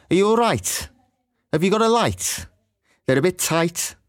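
Scouse I vowel in "right", "light", "tight"; sing-song Scouse "right"; Scouse accent. Shown as a demonstration. A teacher demonstrating the Scouse I vowel. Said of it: "The I sound is also different".

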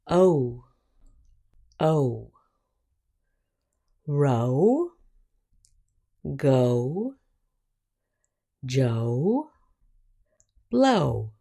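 O, Row, go, Joe, blow with a long "oh". Shown as a demonstration. Teacher modelling long O and its examples, careful pronunciation. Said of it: "long vowels have the same sound as".

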